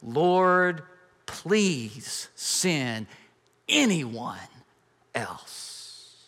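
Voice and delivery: slow, fading plea trailing into a breath; weary plea. Acted. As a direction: beg to be let off, voice dying away. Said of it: "But Moses again pleaded".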